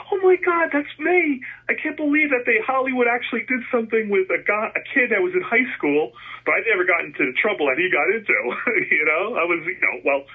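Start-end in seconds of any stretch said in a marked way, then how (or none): 0.1-6.1 very high, excited "oh my God"; thrilled to see himself on screen; excited recognition. Retold or imitated. Man recalling seeing his double in a movie, excited.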